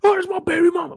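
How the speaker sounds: high shouted announcement; yelled call. Retold or imitated. Warning listeners about someone yelling baby mama, shouting. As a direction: yell it across the room. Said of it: "When you hear baby mama out loud".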